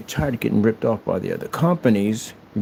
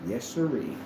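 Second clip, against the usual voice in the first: ordinary delivery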